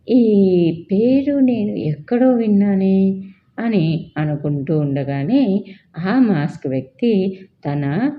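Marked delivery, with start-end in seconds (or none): 0.1-3.2 puzzled wonder. Acted. Virat puzzled by the name.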